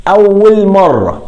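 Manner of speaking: أول مرة drawn out, high and slow; emphasis on أول مرة. Shown as a demonstration. Teacher stresses the key condition.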